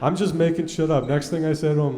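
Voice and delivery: ordinary talk, nothing marked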